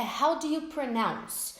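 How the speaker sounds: How do you pronounce said slowly; slow model phrase. Shown as a demonstration. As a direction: clear teacher model. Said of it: "Outra boa de saber é".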